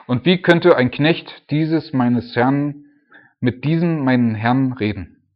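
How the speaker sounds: scripture reading. Acted. Preacher reads Daniel's words loudly to the church. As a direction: proclaim the verses to the congregation.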